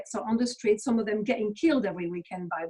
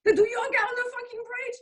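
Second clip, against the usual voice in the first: incredulous; 13 semitones higher than usual. Real, not acted. Incredulous rant about yoga protest.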